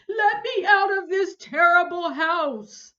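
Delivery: very loud, slow hysterical shriek. Acted. Terrified neighbor shrieking to escape the house. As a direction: wail to be let out. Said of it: "Missus Hipple shrieked on".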